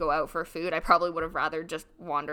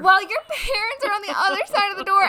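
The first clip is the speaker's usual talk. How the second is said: disbelieving echo; 11 semitones higher, 8 dB louder than usual. Real, not acted. Echoing friend in disbelief.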